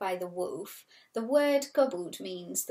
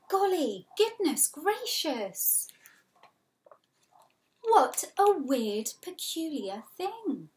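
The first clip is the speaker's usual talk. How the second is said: higher, slow amazed voice; amazed little Ben; 8 semitones higher, 5 dB louder, slower than usual. Acted. Little Ben up the tree, high and amazed. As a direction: marvel slowly like a scared little boy. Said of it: "little Ben said".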